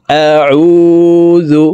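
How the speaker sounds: আউযু with the final u held long, very slow; one-alif lengthening. Shown as a demonstration. Teacher showing the held u in আউযু. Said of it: "উ এক আলিফ টেনেই পড়তে হবে".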